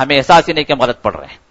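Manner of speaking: ordinary delivery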